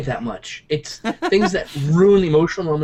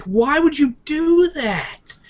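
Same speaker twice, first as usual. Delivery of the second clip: exasperated mock whine; 12 semitones higher, much slower than usual. Mocking exasperated fan question.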